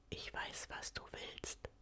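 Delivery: soft whisper close to her ear. A narrator's reading. Lover whispering into her ear in bed, soft whisper. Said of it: "flüstert er mir ins Ohr".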